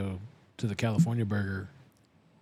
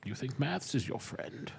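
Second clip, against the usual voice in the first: slow, softer villain voice; Bane parody; 8 dB quieter, slower than usual. Acted. Friend teases the one doing sums, slow Bane impersonation. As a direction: taunt slowly as a masked villain.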